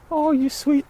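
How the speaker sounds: soaring high cooing to a dog; sweet talk to an old dog, very high; high-pitched pet voice. Shown as a demonstration. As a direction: coo way up high like greeting a pet. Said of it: "raise the tone of my voice".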